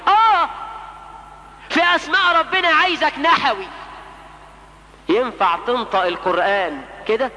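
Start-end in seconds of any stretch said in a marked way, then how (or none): 0.1-3.7 forceful rebuttal. Real, not acted. Preacher rebuts imagined excuse.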